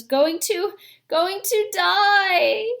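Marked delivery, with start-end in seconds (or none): 1.1-2.7 much higher, slow, breaking on the edge of tears; boy choking up over his dying mother, voice breaking. Acted. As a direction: fight back tears as the voice cracks. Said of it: "trying to keep back your tears".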